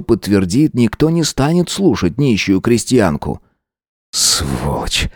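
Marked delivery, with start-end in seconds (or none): 4.1-5.1 low, quiet curse through teeth; through gritted teeth. A narrator's reading. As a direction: spit it out through clenched teeth. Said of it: "процедил я сквозь зубы".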